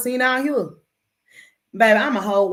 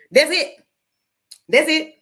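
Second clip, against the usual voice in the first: emphatic; 7 semitones higher, 5 dB louder than usual. Real, not acted. Punchline, louder and higher.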